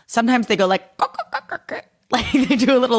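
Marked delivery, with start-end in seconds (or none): 1.0-1.8 high clicking crow sounds that fade away; a quick crow impression, high and clipped clicks. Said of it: "real quick bird impression".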